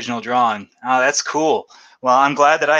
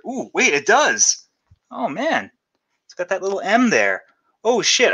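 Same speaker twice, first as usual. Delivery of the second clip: much higher, genuinely amazed gasps of discovery; real surprise; 7 semitones higher than usual. Real, not acted. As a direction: react like you just spotted a hidden treasure. Discovers the hidden signature.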